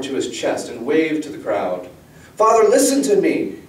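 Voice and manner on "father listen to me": high, loud demand to be heard; urgent pleading. A narrator's reading. Girl begging distracted father to listen, urgent pleading. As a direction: plead hard, voice pitched right up.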